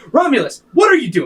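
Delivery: high, loud, amused scolding; laughing Romulus. Acted. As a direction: tease through a laugh. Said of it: "Romulus go like".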